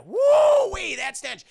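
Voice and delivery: loud, high hammed-up "woo-wee"; mock disgusted whoop. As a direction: whoop as if hit by a stink. Mock reaction to a stench.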